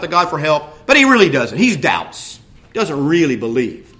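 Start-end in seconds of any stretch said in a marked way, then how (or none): none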